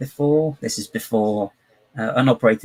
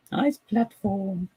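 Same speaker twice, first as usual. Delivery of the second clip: funny voice; 10 semitones higher, much slower than usual. Pretend. Odd slow high voice.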